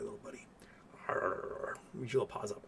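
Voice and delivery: soft whisper to the cat. Real, not acted. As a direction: hush your voice for the little pet.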